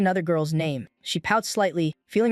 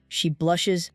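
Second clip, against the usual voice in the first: usual speaking voice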